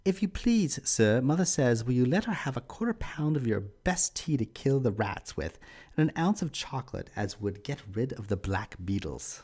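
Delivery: put-on high little English child asking in an English accent. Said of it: "Here's my English accent".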